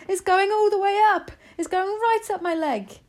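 high-pitched loud panicky yell. A narrator's reading. Cook reporting a mouse up his leg, yelling. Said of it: "the man was yelling".

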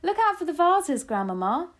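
high, loud shouted caution; alarmed warning. A narrator's reading. Child warning Grandmama about the vases, alarmed. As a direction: call out before something breaks.

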